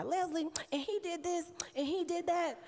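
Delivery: high, whining list of grievances; whiny complaining. Retold or imitated. Complaining to Leslie about divorce.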